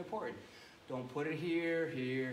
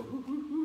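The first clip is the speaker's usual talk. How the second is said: playful monkey hoo sounds; monkey hoots; 12 semitones higher, 4 dB louder than usual. Shown as a demonstration. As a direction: hoot like a monkey for the kids. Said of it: "make a monkey noise go".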